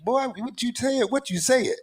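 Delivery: put-on high, playful country boy voice; a country boy hollering it out. Host hams up country boy voice.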